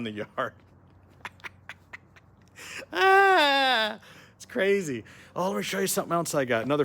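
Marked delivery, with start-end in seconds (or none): 2.9-4.0 long, high, loud "aah"; mock scream. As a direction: let out a hammed-up drawn-out scream. Clowning after the guard-dog bit.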